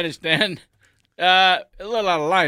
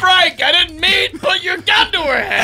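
shout; 12 semitones higher, 8 dB louder than usual. Acted. Shouting at partner.

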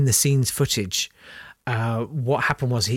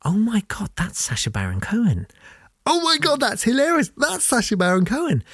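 excited recognition; 9 semitones higher than usual. Retold or imitated. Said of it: "and they go".

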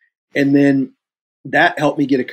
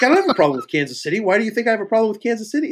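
imitating rapid-fire defensive arguing; fast, higher-pitched indignant protest; defensive retort; 10 semitones higher, much faster than usual. Director denying a problem to actor, defensive.

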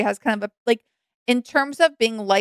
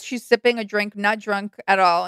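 ordinary delivery

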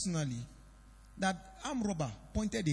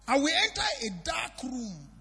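vowing shout; 6 semitones higher than usual. Retold or imitated.

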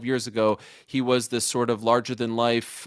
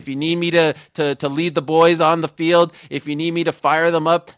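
louder, pumped-up pitch to lead the team; fired up; 5 semitones higher, 6 dB louder than usual. Real, not acted. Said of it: "I am fired up".

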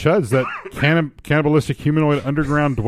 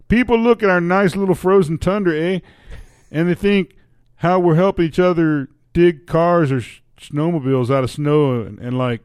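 put-on Canadian accent, pitched up at first; 4 semitones higher than usual. Restarting after cohost's complaint, in a Canadian accent. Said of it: "you're not using the accent".

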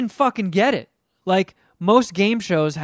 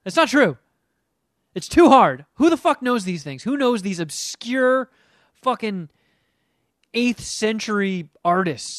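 swearing tirade; 4 semitones higher than usual. Real, not acted. Ranting about Jeopardy.

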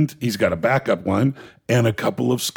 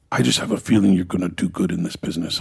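put-on Danish accent; 5 semitones lower than usual. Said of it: "He's got this Danish accent".

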